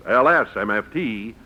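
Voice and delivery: normal talking voice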